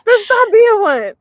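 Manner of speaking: high, loud, drawn-out comeback; playfully exasperated, stretched-out delivery; sassy retort. Acted. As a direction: hammer the obvious advice home, slow and high. Repeats acted retort after laughing.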